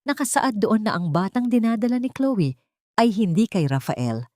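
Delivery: usual speaking voice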